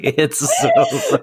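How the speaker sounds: real high-pitched laugh through the words. Real, not acted. Co-host tickled by the door scene, laughing as they talk. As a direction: crack up while saying it.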